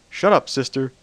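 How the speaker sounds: loud, sharp rebuke to a sister; booming. A narrator's reading. Celestia silences laughing Luna, booming. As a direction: snap at her giggling sister, booming. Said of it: "Celestia's voice boomed".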